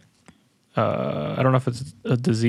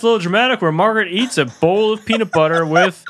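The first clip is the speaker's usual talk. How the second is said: hammed-up game show host intro; big mock TV announcer, slowed; 6 semitones higher than usual. Mock show intro about Margaret.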